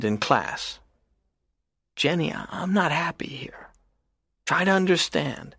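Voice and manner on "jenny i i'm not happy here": whispered confession. Acted. Paul confiding in Jenny, whispered confession. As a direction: whisper it, barely there.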